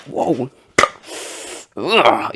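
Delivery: loud goofy whoa and ugh, high; playful gym-floor grunts to shake off stress; weird goofy noises. Shown as a demonstration. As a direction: let out a silly shaking-off noise. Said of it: "make some weird noises".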